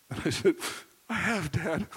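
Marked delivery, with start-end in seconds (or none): 1.1-1.9 high, sniffly mock crying; retold crying. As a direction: blubber to Dad, imitating a sobbing kid. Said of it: "I was snorting and crying".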